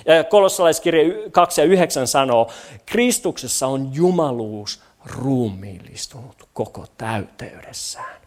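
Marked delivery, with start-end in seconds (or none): 2.9-8.2 low voice fading to very soft; reverent, dwindling scripture reading; hushed awe. Real, not acted. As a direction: read it low, sinking into awe. Awed reading of key verse.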